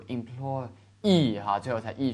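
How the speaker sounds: "employee" light at first, then a strong, long final EE; stress on final EE. Shown as a demonstration. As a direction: hold back on "employ-", land on the "-ee". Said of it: "轻轻的读".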